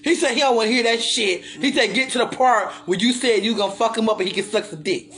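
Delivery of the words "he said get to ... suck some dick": normal talking voice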